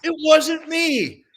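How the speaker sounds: imitating an outraged teammate; indignant denial. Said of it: "He's like".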